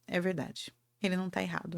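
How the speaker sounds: quietly giving in; softly conceding her husband is right. Retold or imitated. Resigned agreement, much softer.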